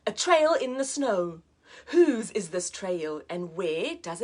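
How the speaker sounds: put-on lively read-aloud for children; teacher reading a picture book to a child, lively; storybook narration. A narrator's reading.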